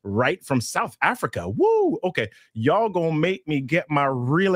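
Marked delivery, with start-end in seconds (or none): 1.5-2.0 high, sudden woo of delight; excited whoop. Real, not acted. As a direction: whoop for the faraway viewer. Cheering a shout-out from South Africa.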